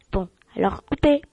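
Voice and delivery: normal talking voice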